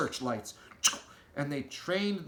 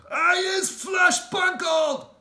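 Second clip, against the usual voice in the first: slow, much higher, very loud roar; roaring giant; 10 dB louder, slower than usual. Acted. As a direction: bellow it like a furious trussed giant. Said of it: "roared the flesh lump eater".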